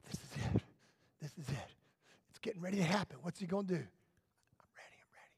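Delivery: breathless whisper, holding still; tense hushed anticipation. Acted. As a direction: whisper it like the big moment is here. Blind man waits for Jesus' touch.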